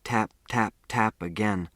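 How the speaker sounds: plain reading voice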